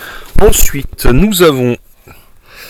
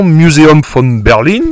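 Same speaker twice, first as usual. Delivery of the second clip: slowed mock German accent; light, slowed German accent on the museum name; 3 semitones higher, slower than usual. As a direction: say it like a German tour guide, half in jest. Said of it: "excusez-moi pour l'accent allemand".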